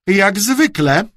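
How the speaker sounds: high, loud, cutting reproach; said through clenched teeth. A narrator's reading. Aunt to the late uncle, said through clenched teeth. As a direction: force it out with disapproval. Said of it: "wycedziła".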